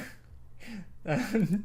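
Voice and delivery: high burst of laughter; real laugh. Real, not acted. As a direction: laugh out in disbelief. Laughing after outburst.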